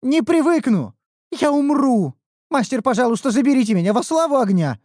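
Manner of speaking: high, loud begging; desperate plea. Acted. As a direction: beg the master to take you back. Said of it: "взмолилась Нюру".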